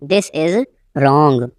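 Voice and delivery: teacher setting "wrong" apart, falling; broken-up repeat. Shown as a demonstration. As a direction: pause before "wrong".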